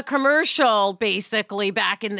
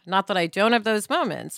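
ordinary talk

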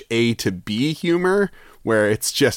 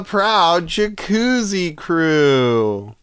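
announcer; 4 semitones higher, much slower than usual. Acted. Announcer sign-off drawn out.

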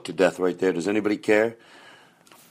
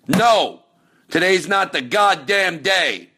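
much higher, punchy yell of defiance; fired-up pep talk; 8 semitones higher, 3 dB louder, slower than usual. Acted. Coaching a struggling listener, a fired-up yell. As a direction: shout the listener into action.

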